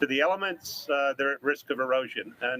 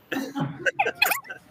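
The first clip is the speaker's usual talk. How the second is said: squeaky sped-up giggle; chipmunk laugh. Real, not acted. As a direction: laugh pitched far higher by glitched audio. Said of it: "You sound like you're a chipmunk".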